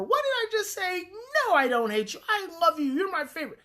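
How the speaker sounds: high, airy, lighthearted reassurance; mocking the wrong, playful parent answer; mock breezy brush-off. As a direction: toss it off lightly, high and sing-song.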